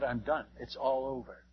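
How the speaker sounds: retold hopeless resignation; resigned. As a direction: let it drop as if giving up. Despairing thought as he sinks.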